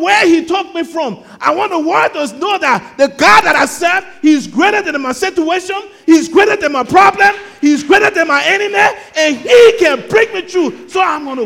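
loud, high, driving sermon declaration; full-force preaching. Real, not acted. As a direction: preach it out at the top of your voice.

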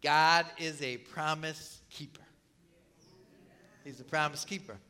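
normal talking voice

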